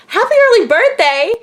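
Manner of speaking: imitating a high, loud cheer; excited birthday wish. Star greets mom for her birthday.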